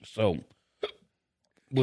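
halting between hiccups. Real, not acted. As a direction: stop and start with hiccups. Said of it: "In between hiccups".

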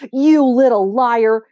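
shouting back. Acted. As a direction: yell it right back at him. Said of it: "she shouts back".

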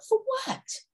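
high, confused question; puzzled for what. Retold or imitated. The offender asking why in mock bewilderment. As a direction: play the baffled offender. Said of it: "they may ask".

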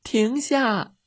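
put-on high, urgent child's call; shouting. A narrator's reading. Girl stopping the dog from jumping, shouting. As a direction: call out high to halt them. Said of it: "茉莉大喊".

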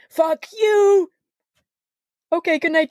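high cursing then a sudden sign-off; drunk ranting. Retold or imitated. Drunk guy cursing then bidding everyone good night.

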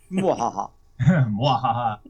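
hammed-up evil villain laugh; mock mwahaha villain cackle; sneering panto villain. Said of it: "Michael, speaking of evil villains".

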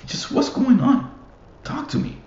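mock high-voiced teammate demanding answers; exasperated locker-room pep talk. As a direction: rise way up into a squeak as if begging the team to talk. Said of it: "I'll be like".

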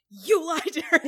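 loud, high mock outburst breaking into laughter; dramatic accusation. Cohost imitates the accusing friend, dramatic mock outburst. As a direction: blurt it with hammed-up drama. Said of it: "he's so dramatic in this moment".

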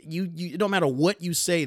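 normal talking voice

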